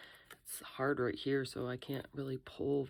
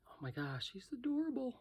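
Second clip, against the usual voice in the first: high, soft gushing; overwhelmed by cuteness; 9 semitones higher, 5 dB quieter than usual. Real, not acted. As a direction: coo over something adorable. Admires cute lizard.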